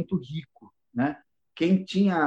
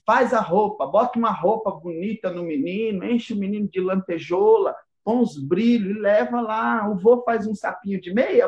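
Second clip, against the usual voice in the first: bossy godmother; 5 semitones higher than usual. Retold or imitated. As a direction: push it like a pushy godmother. Godmother giving orders.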